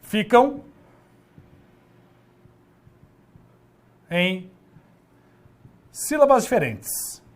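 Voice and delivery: slow, word by word, with long pauses; slow dictation. Shown as a demonstration. Teacher dictating the definition to the class, slow and spaced. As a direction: dictate it slowly for students to copy. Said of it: "teacher dictating the definition slowly".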